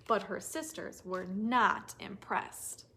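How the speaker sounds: usual speaking voice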